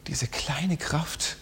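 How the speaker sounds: very slow, very soft whisper; slow hushed refrain. Acted. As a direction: linger on each word in a whisper.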